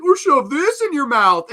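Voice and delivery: over-the-top villain imitation, loud and very high; Fat Stuff. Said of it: "just so over the top".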